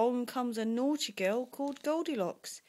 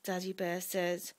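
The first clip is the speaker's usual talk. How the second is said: usual speaking voice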